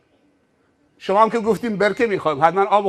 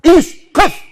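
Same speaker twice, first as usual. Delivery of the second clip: high, loud shouted halt; forceful command; 11 semitones higher, 9 dB louder than usual. Acted. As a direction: bark two sharp orders to stop. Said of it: "با قدرت میگه، خیلی هم قویه". (فریاد محکم و آمرانه)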